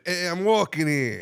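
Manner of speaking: toned-down New York pedestrian's protest; New York accent. Acted. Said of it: "You said it a little different".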